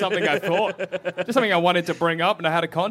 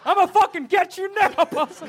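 mock angry threat; 10 semitones higher than usual. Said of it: "And you're like".

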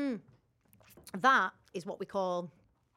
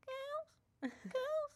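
put-on high sing-song calling out of girls; mock high call; 8 dB quieter than usual. Friend calling the girls at the pool, high mock sing-song. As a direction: call the girls like a giddy friend back from a night out.

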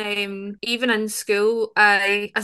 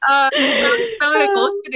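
high, laughing through the words; 11 semitones higher, 4 dB louder than usual. Real, not acted. Guest laughing at host's Boston tease. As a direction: giggle as you admit it.

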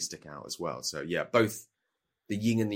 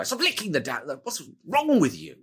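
higher, fed-up snap at the spider licker; exasperated snap; 7 semitones higher than usual. Shown as a demonstration. Wizard snapping at companion licking spider goo, exasperated. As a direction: make "Stop licking the damn" sound truly fed up. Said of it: "you would go".

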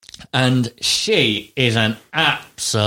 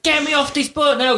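high-pitched mock outraged demand; having a go. Retelling the woman demanding off the boat, mock furious. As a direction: imitate her shrieking an order. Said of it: "having a go at him".

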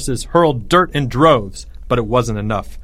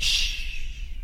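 soft, voiceless shushing; hissed hush; 9 dB quieter than usual. A narrator's reading. Leo silences his trapped soldiers, hissed hush. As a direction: hush them with a hiss. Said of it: "Leo hissed".

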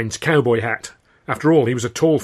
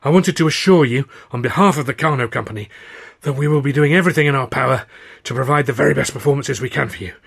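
raised, winded speech; loud, breathless pleading; out of breath; 4 semitones higher, 7 dB louder than usual. A narrator's reading. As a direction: pant out assurances after running. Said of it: "trying to haul some warmer air".

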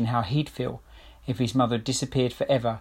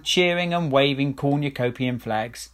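usual speaking voice

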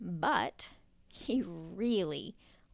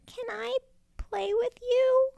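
high, louder hopeful call; 5 dB louder than usual. Acted. Turtle calling to the bears in the lake, hopeful. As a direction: call across the water bravely. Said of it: "he called out".

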